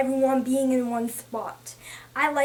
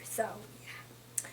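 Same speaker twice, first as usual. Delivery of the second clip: whispered trailing off; 5 semitones lower, 18 dB quieter than usual. Real, not acted. Trailing off after sad point.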